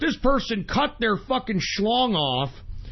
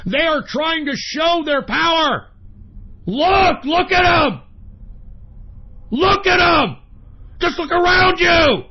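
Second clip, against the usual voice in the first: higher, louder urgent yell; angry shout; 7 semitones higher, 5 dB louder than usual. Acted. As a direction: hammer the warning home. Warning listeners.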